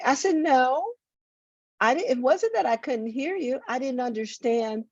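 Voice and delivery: ordinary talk, nothing marked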